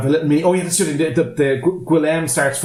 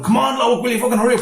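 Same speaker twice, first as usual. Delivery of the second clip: higher, urgent retold holler; mock impatient yell; 9 semitones higher, faster than usual. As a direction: imitate someone hurrying a panicked climber. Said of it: "they're screaming at him to hurry up".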